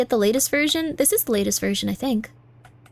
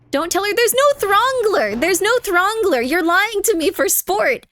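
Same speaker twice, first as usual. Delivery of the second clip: raised-pitch outraged denial, drawn slow; indignant protest; 7 semitones higher than usual. Real, not acted. Streamer protesting to chat about the fake Throngler, indignant and high. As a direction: insist they're lying to you.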